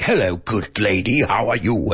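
lightly put-on British greeting; playful imitation British accent; mock British accent. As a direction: imitate a proper Brit saying hello. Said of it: "exaggerate each other's accents".

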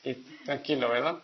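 a hint of a lazy, carefree fellow; careless man. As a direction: murmur it like a man who doesn't care. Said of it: "el Señor ya dijo".

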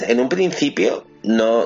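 ordinary talk, nothing marked

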